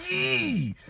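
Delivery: high, drawn-out "yee" of joy; excited squeal. Retold or imitated. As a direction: squeal with delight, holding it long. Celebrating news of getting the part.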